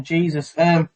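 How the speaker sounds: very faint muffled headset audio; quiet, far-off headphone mic; headphones used as mic. Real, not acted. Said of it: "Haru, you're very quiet".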